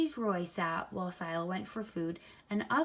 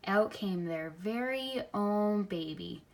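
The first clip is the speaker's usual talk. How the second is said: usual speaking voice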